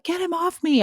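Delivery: high, strained mock scream without volume. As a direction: imitate trying to scream under a heavy weight. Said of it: "trying to scream".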